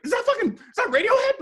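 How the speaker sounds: high, loud astonished question; gasping at a famous face in the photo; amazed disbelief. Retold or imitated. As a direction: squeal it in disbelief. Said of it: "people would look at my portfolio".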